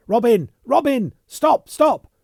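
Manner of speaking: very high, loud cries; shouting. Acted. Yeovil running up the corridor, shouting for Robin, very high and loud.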